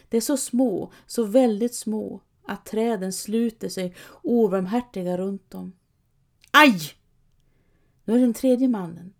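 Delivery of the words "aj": high loud yelp; pained cry. A narrator's reading. Trull hurt by his tooth, loud yelp. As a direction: yelp out in sudden pain. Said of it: "Trull, som ropar".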